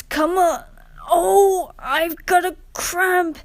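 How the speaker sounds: high, loud pained cry; groaning in pain. A narrator's reading. Rheneas stuck with a cramp, groaning high. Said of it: "he groaned".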